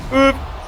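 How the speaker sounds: short, high, loud boop; imitated boop. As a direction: pop out a little cartoon noise. Imitates spinning armor sound.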